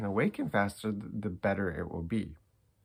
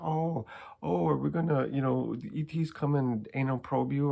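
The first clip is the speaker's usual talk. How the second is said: higher-pitched mocking imitation of scoffers; mocking coworkers; 6 semitones higher than usual. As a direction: mimic people making fun, pitched up. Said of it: "We are made fun of".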